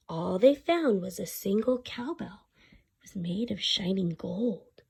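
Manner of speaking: hushed storytelling whisper. A narrator's reading. Telling children about the golden cowbell, hushed whisper. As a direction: breathe it out like a secret.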